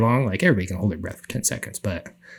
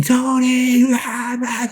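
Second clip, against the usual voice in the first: high, loud mock yelling of a name; frantic yell; 13 semitones higher, 3 dB louder, much slower than usual. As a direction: call out frantically from afar. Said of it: "my buddies yelling".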